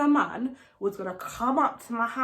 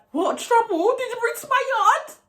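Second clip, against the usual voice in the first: high-pitched, incredulous demand; indignant confrontation; 10 semitones higher than usual. Retold or imitated. Confronting friend who brought trouble.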